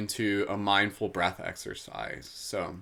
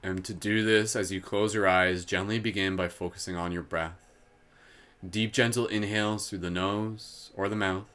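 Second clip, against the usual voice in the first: guided meditation. Acted. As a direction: draw the listeners gently inward.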